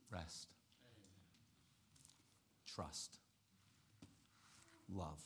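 hushed, gentle closing words; soft. Acted. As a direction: let each word fall softly.

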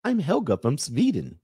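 high, girlish mock Swedish accent; Helga. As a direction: lift it high into a sing-song fake Swedish accent. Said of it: "Everybody thinks Swedish chick's gonna be like".

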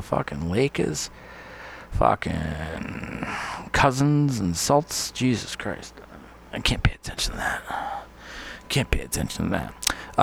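soft, half-whispered aside to self; distracted murmur. Real, not acted. Host distracted by texts, muttering softly. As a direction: mutter it away from the mic.

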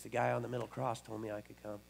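low, hushed reply; simple retold answer. Thief answering at pearly gates, quiet retold reply. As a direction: drop right down, soft and plain.

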